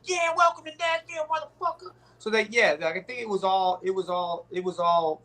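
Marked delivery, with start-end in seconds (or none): 0.0-1.9 high-pitched imitation of a drive-by taunt; mock taunting passer-by. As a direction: jeer it way up high, like someone driving past. Imitating a taunt from passing car.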